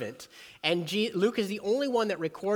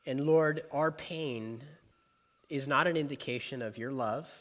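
prayer. Acted. Leads a prayer.

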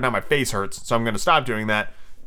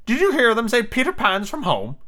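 high sidekick voice; high-pitched, fidgety henchman; Smee; 10 semitones higher than usual. Acted. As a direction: ask the captain furtively in a high voice. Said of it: "Smee whispered".

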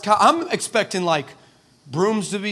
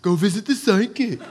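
mocking complaint. Rejected preacher turning around to heaven, mocking. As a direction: sarcastically parrot the instruction. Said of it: "I turn around and I go".

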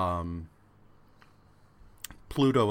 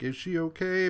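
much higher, mock-anxious question; worried friends; 9 semitones higher than usual. Wendy and Richard asking about Katie in a mock-worried voice. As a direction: squeak it out with mock worry. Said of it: "they're just like".

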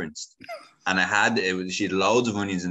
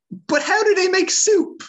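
much higher, louder incredulous auntie; squealing aunt; 7 dB louder than usual. Retold or imitated. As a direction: shriek it up high in disbelief. Auntie imagined asking in shock.

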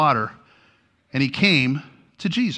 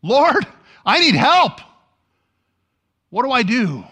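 panicked scream; 10 semitones higher, 5 dB louder than usual. Acted. Preacher voices drowning Peter, high loud panicked cry. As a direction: yell as if drowning. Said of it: "he screams out in panic".

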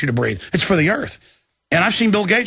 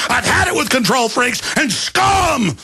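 angry rant; 6 semitones higher than usual. Real, not acted. Ranting at control freaks.

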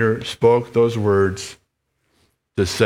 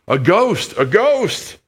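rising higher, scared, squeaky mouse cry; frightened head mouse; 9 semitones higher, slower than usual. Acted. Pastor voicing the terrified head mouse pointing at Desborough. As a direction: gasp it out like you've seen a ghost. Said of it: "raised a shaking paw".

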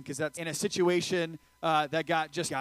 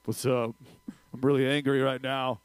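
put-on voice, lower than usual; comically contained anger, pitched down; mock angry voice; 4 semitones lower than usual. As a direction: play at being mad in a silly altered voice. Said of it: "You speak in a really high voice".

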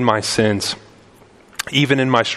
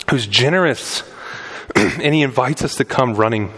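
ordinary talk

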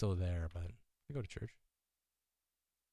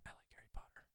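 whispering; 24 dB quieter, faster than usual. Real, not acted. Whispered confession.

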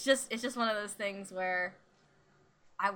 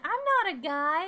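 put-on indignant little girl; 8 semitones higher, 7 dB louder, faster than usual. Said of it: "a little girl will be like".

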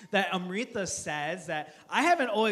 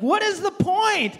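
high, loud mock exasperation; hammed-up indignant question; exasperated shout; 11 semitones higher, 8 dB louder, slower than usual. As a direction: demand it in comic disbelief. Mock protest at cake saying.

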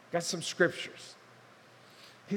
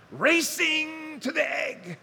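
higher, louder, slowed-down shout; excited shout; 10 semitones higher, 5 dB louder, much slower than usual. Acted. Pastor picturing the sperm race for the congregation, excited shout. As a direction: stretch it out like a race call.

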